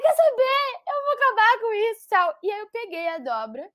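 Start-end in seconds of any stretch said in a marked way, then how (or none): 0.0-2.1 high, louder defiant outburst; tearful resolve. Retold or imitated. Deciding to destroy her ex's wallet, high defiant cry. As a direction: declare it through your tears. Retold resolve while crying.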